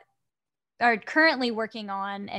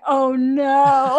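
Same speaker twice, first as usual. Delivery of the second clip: mock dismay; 3 semitones higher, 10 dB louder than usual. Reacting to being asked to sing.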